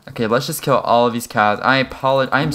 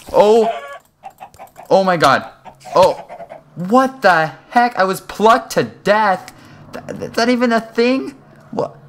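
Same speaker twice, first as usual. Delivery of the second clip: outraged disbelief; 10 semitones higher, 4 dB louder than usual. Real, not acted. To the viewers after dying, high outraged disbelief. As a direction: howl in disbelief at the death.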